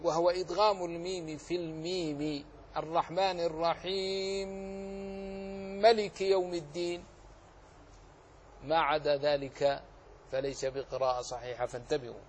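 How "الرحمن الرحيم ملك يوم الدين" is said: الرحيم ملك with the two م merged into one doubled م; Abu Amr merging. Shown as a demonstration. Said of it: "بقراءة أخرى يقرأ بها أبو عمرو".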